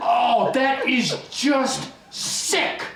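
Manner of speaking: slow, high, angry yell; disgusted shout. Acted. Angry patient reacting to worms eating poo, disgusted shouting. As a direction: shout it out, stretching each word.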